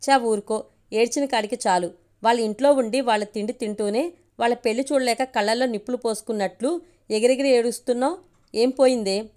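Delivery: usual speaking voice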